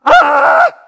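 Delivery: huge high shrieking scream; panicked scream. Pretend. Congregation screaming as the casket bursts open, shrieking. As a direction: shriek as the dead man sits up. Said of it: "They probably screamed".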